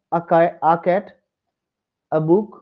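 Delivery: "a" pronounced ah, not ay. Shown as a demonstration. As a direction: say "a" as ah in "a cat, a book". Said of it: "is usually pronounced a".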